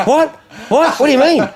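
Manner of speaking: high, loud, baffled imitation; mock bafflement. Lowball caller not understanding the dealer's binoculars joke, baffled.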